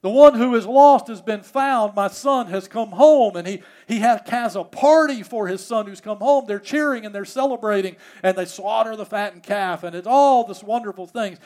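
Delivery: loud, high-pitched sermon storytelling; impassioned preaching. Acted. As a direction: preach the homecoming with rising excitement. Preaches story at full force.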